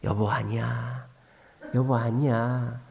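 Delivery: imitating a softly placating spouse; gentle patience. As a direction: let it go tenderly, drawing out the words. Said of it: "근데 요즘은 어떡하냐?".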